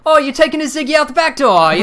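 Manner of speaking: very high, loud mock-British query; screechy hammed-up Brit; British accent. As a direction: put on an outrageous British accent. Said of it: "my favorite British-ism".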